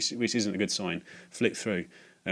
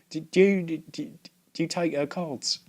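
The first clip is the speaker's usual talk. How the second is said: nervous stammer; 7 semitones higher than usual. Retold or imitated. His own nervous question.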